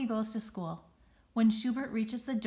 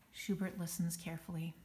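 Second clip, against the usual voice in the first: ordinary delivery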